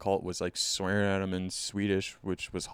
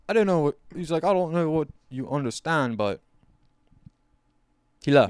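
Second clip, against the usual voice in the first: louder, higher mock exasperation; exasperated Swede; 7 semitones higher, 7 dB louder than usual. Swedish guy telling the fiance he left, louder and exasperated.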